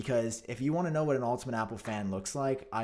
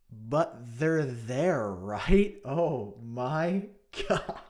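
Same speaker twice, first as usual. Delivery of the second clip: slow, laughing it's there; amused mocking laugh; laughing disbelief; 4 dB louder, much slower than usual. Real, not acted. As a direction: laugh at the absurdity. Laughing at article's claim.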